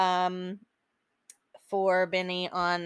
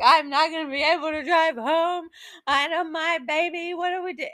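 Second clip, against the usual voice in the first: high, hammed-up sobbing wail; imitated sobbing; 7 semitones higher than usual. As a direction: act out ugly crying through the words. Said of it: "I am sobbing uncontrollably".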